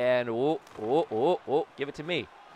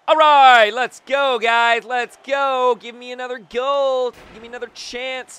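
high, raised, eager urging; rallying cry; 9 semitones higher, 8 dB louder than usual. Real, not acted. Player urging guys on for another goal, excited rallying. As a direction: pump up the team with a high call. Rallying the team as play starts.

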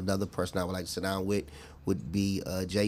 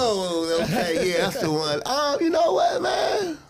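loud, high laughing outburst; laughing burst; 13 semitones higher, 5 dB louder than usual. Real, not acted. Guest cracks up when asked about his guilty pleasure, laughing loudly. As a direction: blurt it out through a big laugh.

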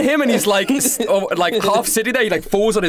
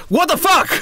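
higher outraged yell; bursting in outraged; 9 semitones higher than usual. Acted. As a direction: crash through the door with it. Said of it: "burst into William's room and goes".

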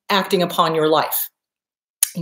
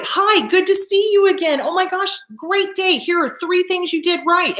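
high, cheery mock self-greeting; perky greeting; 8 semitones higher than usual. Shown as a demonstration. Coach modelling mirror work to listeners, perky high greeting. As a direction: greet yourself like a delighted friend.

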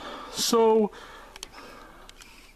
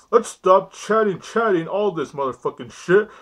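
normal talking voice

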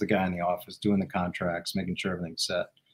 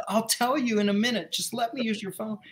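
high-pitched, exasperated bargaining; retold urgent promise, pitched way up; impatient plea; 11 semitones higher, 5 dB quieter than usual. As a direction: push them to hand it over, voice raised in pitch.

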